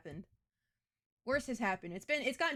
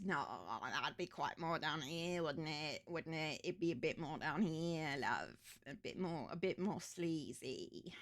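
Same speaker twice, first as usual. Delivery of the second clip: Murdoch, sleazy and low; 5 semitones lower, 6 dB quieter than usual. Pretend. Streamer correcting viewer on Murdoch's voice, low and sleazy. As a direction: drop it down low and slimy, British. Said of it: "The spirit of Murdoch has taken over".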